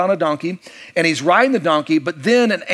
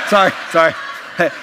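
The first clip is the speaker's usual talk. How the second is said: laughing apology; much faster than usual. Real, not acted. Laughing at his own joke.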